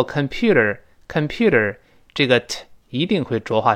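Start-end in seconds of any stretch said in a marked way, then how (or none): 0.0-1.8 "computer" quickly, t softened to a voiced tap; quick reading. Shown as a demonstration. Teacher showing the fast form, quick reading. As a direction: run "computer" together as in fast American speech. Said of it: "读快了之后".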